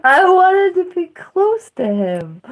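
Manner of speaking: high, teary laugh through the words; half laughing, half crying. Real, not acted. Admitting she wanted closeness, teary laugh.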